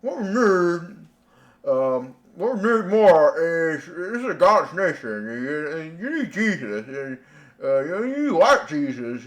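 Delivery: lightly voiced mock sermon; mocking quote.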